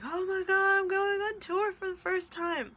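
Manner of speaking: squealing high with thrilled excitement; excited squeal. Retold or imitated. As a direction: relive the giddy thrill of the news, way up high.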